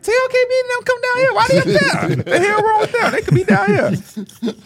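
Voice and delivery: very high, incredulous squeal of talk; excited call. Retold or imitated. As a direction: call out in high-pitched mock indignation. Acting out excited call to friends.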